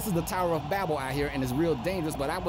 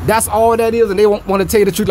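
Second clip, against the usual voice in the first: ordinary delivery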